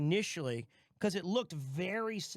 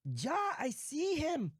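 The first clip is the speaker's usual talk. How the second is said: high put-on voice; 10 semitones higher, slower than usual. Mock phone voice.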